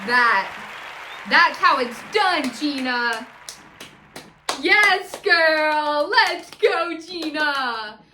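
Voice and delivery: loud, punched-out praise; excited cheering. Real, not acted. Host rooting for Gina after her outburst, loud hype. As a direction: hoot for your favourite, drawing out the words.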